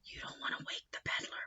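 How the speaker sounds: hushed whisper to keep the peddler asleep. Real, not acted. Teacher whispering to a child not to wake the peddler. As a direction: breathe it so nobody wakes. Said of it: "Now you have to be quiet".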